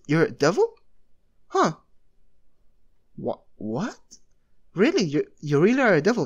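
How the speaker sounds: put-on shocked to learn the kid is a devil. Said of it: "the angel was shocked and says".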